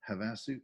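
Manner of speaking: Havasu stressed on the middle syllable; mispronounced place name. Real, not acted. Organizer reads out a town he never said, Havasu stressed mid-word. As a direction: say Havasu with the weight on its second syllable. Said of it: "haven't pronounced that out loud".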